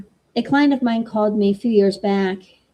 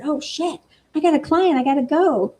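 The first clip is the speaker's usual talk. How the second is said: higher, hammed-up sudden panic; mock alarm; 6 semitones higher than usual. To the client on the phone, a mock startled excuse. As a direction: fake an urgent excuse to get off.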